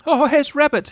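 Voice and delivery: high, loud squeaky relief; a little pig spotting help; glad Piglet. A narrator's reading. Seeing Rabbit arrive, a high glad Piglet. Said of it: "he said gladly".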